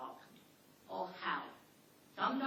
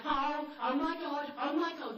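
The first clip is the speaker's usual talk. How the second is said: panicking squealer; 4 semitones higher, 4 dB louder than usual. Retold or imitated. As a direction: play the panicking one in the group. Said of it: "one of the parties goes".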